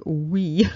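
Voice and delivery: we said like English "wee"; "wee" pronunciation. Shown as a demonstration. Lecturer showing how the French don't say it, we as "wee". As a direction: sound out we with a long ee. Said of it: "they don't pronounce it like".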